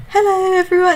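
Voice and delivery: very high, put-on posh hello; shy posh greeting. Imitating the co-host's first stories, quiet, shy, posh voice. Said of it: "you're proper quiet and really shy".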